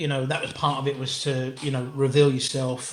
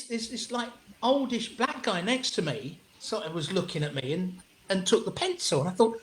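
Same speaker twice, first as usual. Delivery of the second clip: ordinary talk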